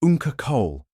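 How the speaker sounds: high, loud happy shout; little boys greeting their uncle; excited yell. A narrator's reading. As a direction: kids calling out gleefully to their uncle.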